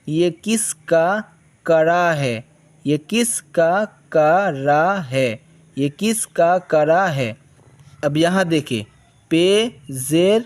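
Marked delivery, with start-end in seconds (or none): 0.1-7.3 slow dictation. Shown as a demonstration. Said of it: "teacher reads slowly".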